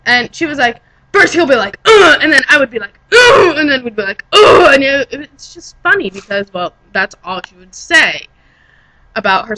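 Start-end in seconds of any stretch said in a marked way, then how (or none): 1.9-2.2 high loud grunt "ugh"; mock grunt. Retelling the friend's sex talk, a mock loud grunt. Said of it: "grunting about it". 3.1-3.6 very high loud "ugh" grunt; bigger, shouted grunt; mock grunt. Friend describing her own part, a louder mock grunt. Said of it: "grunting about it". 4.3-4.7 high loud "ugh" grunt; mock grunt. Friend describing both of them, a hammed-up grunt. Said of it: "grunting about it".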